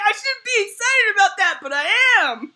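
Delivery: high, loud giddy confession; mock ashamed but thrilled; guilty glee. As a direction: squeal it out, half laughing. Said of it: "And I was like".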